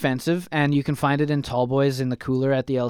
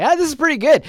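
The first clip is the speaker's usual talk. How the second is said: excited; 13 semitones higher, 8 dB louder than usual. Retold or imitated. Retold drinking PBR.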